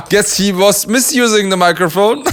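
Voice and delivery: high-pitched, through real laughter; laughing. Real, not acted. Reactor joking about the microphone rule, laughing. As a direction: crack up at your own joke while talking.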